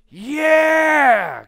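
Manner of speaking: a loud, startled pretend reply from the crowd; mock shout. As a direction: blurt it out loud like a spooked listener. Listener's mock reply to yelling.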